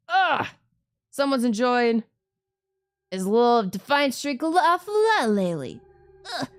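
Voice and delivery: loud, high sneering scold; scornful guard. Acted. Guard dragging the boys off, scornful. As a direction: grab them and sneer.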